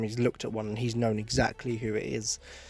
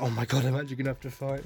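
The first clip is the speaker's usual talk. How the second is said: mock panic; faster than usual. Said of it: "he's like".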